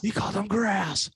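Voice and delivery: high-pitched mock audience hype; gleeful high mock cheer; crowd going crazy. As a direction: echo the insult like a thrilled onlooker. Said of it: "people start clapping and going crazy".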